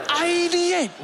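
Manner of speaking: high, mocking ideate; squeaky mocking repeat of Ideate; mock echo. Mocking the boss's word.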